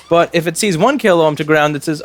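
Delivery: normal talking voice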